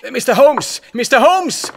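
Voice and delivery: high, loud excited calling; agitated, hurried. Acted. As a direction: call out breathlessly, in a great hurry. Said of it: "wie immer aufgeregt und in höchster Eile".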